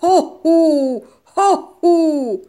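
loud booming hoo-hoo roar; gorilla roar. A narrator's reading. The masked gorilla roaring in the wolf's face, booming. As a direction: roar it out to scare. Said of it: "فریاد زد".

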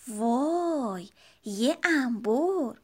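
slow drawn-out wow at the pliers; a cub spotting scary tools; awed little sister. Acted. Sister cub spots pliers on the tray, slow and awed.

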